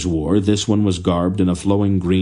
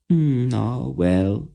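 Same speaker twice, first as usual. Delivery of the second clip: playful, higher mock-sighing "well"; 6 semitones higher, slower than usual. A narrator's reading. The queen giving up the men, a mock sigh. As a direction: sigh in pretend disappointment. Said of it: "with a mock sigh".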